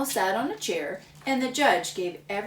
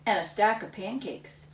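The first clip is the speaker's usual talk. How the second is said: ordinary delivery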